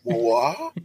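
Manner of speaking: high, affronted gasp of a word; clutching pearls, scandalized; shocked pearl-clutcher. Retold or imitated. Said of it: "I'm clutching my pearls".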